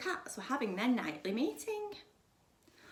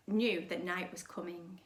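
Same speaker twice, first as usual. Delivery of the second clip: plain reading voice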